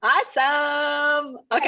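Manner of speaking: excited. Real, not acted. Enthusiastic reply to greeting.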